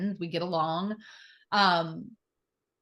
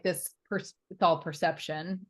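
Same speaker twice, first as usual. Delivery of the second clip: usual speaking voice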